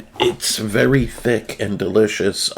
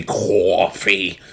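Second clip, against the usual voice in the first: exaggerated New Jersey "coffee", drawn-out aw vowel; mock New Jersey "cawfee"; New Jersey accent. Shown as a demonstration. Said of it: "I don't want my New Jersey accent".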